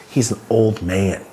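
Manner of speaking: ordinary delivery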